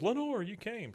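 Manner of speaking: high-pitched acted-out delight; delighted greeting. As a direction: imitate a thrilled welcome, voice jumping up. Bill greets Lenore at party.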